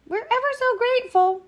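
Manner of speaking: put-on grateful little girl. A narrator's reading. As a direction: gush like a relieved child. Girl thanks the hunter.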